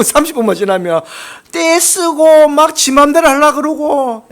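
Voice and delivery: high, slow teasing imitation of a tantrum child; mock whiny child. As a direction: drag it out like a sulky kid. (떼쓰는 아이를 높고 느리게 흉내)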